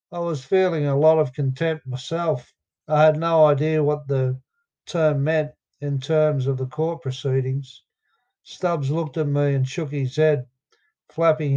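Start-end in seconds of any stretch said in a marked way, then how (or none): none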